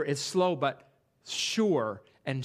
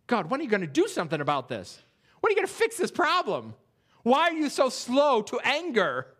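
frustrated plea to God; 6 semitones higher than usual. Retold or imitated. Voicing a frustrated prayer.

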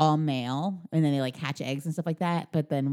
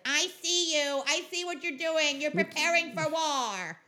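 menacing leader voice; 9 semitones higher, slower than usual. Retold or imitated. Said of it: "he's like".

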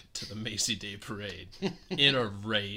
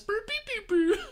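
very high squeaky babble; creature gibberish; faster than usual. Retold or imitated. Showing how the aunts sound, high squeaky babble. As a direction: squeak the nonsense like a tiny creature. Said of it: "That's how they sound".

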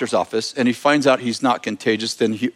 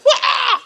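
high-pitched imitated crying shriek; hammed-up "wah" of a scream; mock screaming wail. Retelling the secretary running out in tears, mock wail. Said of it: "goes out screaming and crying, just like".

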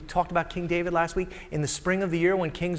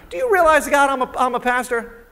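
much higher, louder whining pastor; whiny complaint; 11 semitones higher, 7 dB louder than usual. Acted. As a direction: whine to God like a put-upon pastor.

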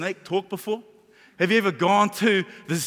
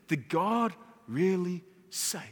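slow, hushed serpent's question; whispering snake; 6 dB quieter, slower than usual. Acted. Serpent tempting Eve in her ear, slow and hushed. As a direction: draw each word out softly, insinuating doubt. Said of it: "The snake whispered".

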